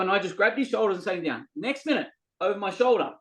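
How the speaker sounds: ordinary delivery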